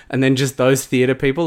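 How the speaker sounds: ordinary delivery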